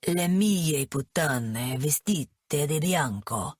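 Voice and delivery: put-on slow, low Italian accent dripping with contempt; a cruel Italian boss savouring each word slowly; disdainful Italian accent. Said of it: "his thick accent ripe with disdain".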